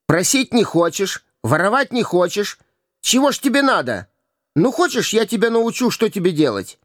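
put-on cocky street boy. A narrator's reading. Hugo presses the prince to go begging, swaggering. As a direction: coax and bully by turns.

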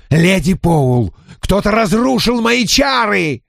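outraged, shouting high; furious shout. A narrator's reading. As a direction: scream accusation in fury. Gentleman enraged.

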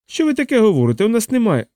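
high-pitched, affronted denial; indignant hotel woman. Acted. Key keeper answering Vlad's cockroach excuse, indignant. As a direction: protest it high, as if the hotel were insulted.

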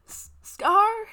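very high, very loud stammered cry; choked cry. Acted. Green seeing Scar facing vampires, choked cry. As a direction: choke out his name in panic. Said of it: "he chokes out".

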